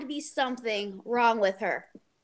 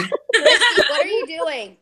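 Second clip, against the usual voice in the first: louder, higher laughing question; laughing call; 4 semitones higher, 9 dB louder, slower than usual. Real, not acted. Laughing as she asks Christy about the pig noise on the phone. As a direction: call out to her friend through laughter.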